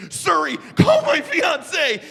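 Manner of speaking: high, slow mock-desperate yell; panicked call. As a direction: cry out each word to the phone for help. Panicked, calls out to phone assistant.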